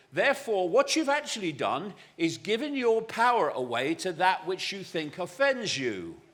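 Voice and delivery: ordinary delivery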